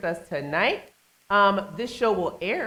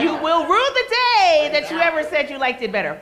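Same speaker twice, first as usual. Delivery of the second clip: loud, high mock outrage; hammed-up warning, high and loud; mock threat; 7 semitones higher, 5 dB louder than usual. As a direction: playfully threaten them at full voice. Mock threat over macaroni, just kidding.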